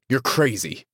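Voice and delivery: understated explosion; exploding. A narrator's reading. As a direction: burst out in disbelief. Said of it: "he exploded".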